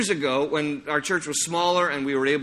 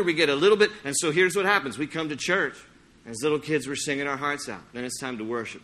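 ordinary talk

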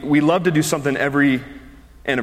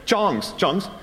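put-on higher, clipped Brazilian-accented question; a hint of a Brazilian server's accent; thick accent; 5 semitones higher than usual. Imitating the Brazilian-accented server offering tongs. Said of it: "pretty thick accents".

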